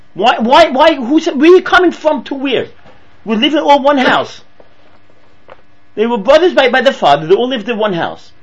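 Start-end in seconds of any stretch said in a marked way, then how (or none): 0.1-4.4 indignant rebuke. Retold or imitated. Acted indignant rebuke of dream.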